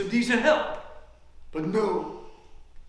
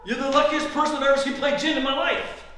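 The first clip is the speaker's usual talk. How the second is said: louder, higher, fast grumbling; exasperated complaint; 5 semitones higher, 5 dB louder, faster than usual. Acted. Losing player to lucky opponent, exasperated. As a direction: protest the winner's luck in a rush.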